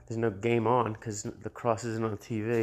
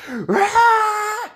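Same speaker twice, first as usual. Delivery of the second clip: high, loud, imitated wail of frustration; mock crying yell; 12 dB louder than usual. Employee storming out after being mocked, with a loud crying yell. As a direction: belt out a mock crying shriek. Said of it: "she goes".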